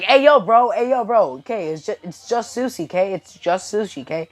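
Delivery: loud, high start, dropping in volume; alarmed protest. Acted. Izuku calming sensei after the proposal, flustered. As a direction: hurriedly talk someone down.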